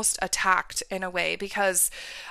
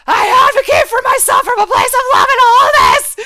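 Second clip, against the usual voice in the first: roared, much higher and louder; 15 dB louder than usual. Retold or imitated. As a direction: roar it out with full power. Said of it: "And I roared".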